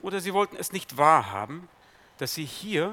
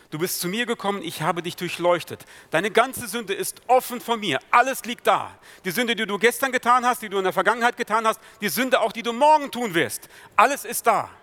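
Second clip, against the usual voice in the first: higher and louder, building intensity; preacher thundering that every sin is laid bare; full preaching; 5 semitones higher, 7 dB louder than usual. Acted. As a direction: drive it home from the pulpit. Preacher rises to full force.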